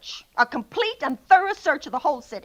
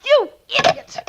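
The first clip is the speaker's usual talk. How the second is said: hurls the insult, higher and louder; furious outburst; 4 semitones higher, 7 dB louder, slower than usual. Acted. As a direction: snaps it out in a fit of rage at the phone. Hanging up on the police sergeant.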